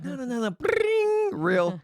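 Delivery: high, slow pleading no no no; mock desperate plea. Host mocking himself begging in the bathroom, high whiny plea. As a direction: whine it up high like begging for a reel. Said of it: "I'm like".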